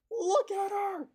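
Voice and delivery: a very high, loud squeal of delight; squealing excitement. Real, not acted. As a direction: gush over her like a proud owner. Showing off her tattoo.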